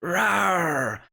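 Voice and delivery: high, loud mock roar; a child's pretend monster "rawr"; half-hearted monster roar. Playmate obliges as the foul monster, drawn-out mock roar. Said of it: "another voice be like".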